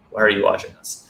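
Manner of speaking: normal talking voice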